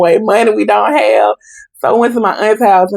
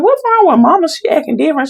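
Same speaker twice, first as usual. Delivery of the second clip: alarmed question; 8 semitones higher, faster than usual. Retold or imitated. Worried teen asking about mom.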